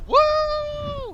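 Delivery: very high, loud joyful whoop; excited whoop. Acted. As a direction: whoop with glee. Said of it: "I go".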